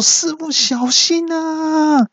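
very high, slowed-down cry of alarm; a high, drawn-out warning cry; warning shout. Acted. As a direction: call out to warn the master. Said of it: "我就大叫说".

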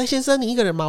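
high, eager sales pitch; a friendly hawker's high greeting; salesman pitch. Retold or imitated. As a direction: mimic a pushy street salesman. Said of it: "他就把我拦下来说".

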